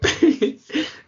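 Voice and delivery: high, loud helpless giggle; can't hold it in; helpless laugh. Real, not acted. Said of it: "you're pulling a funny face and I can't take it anymore".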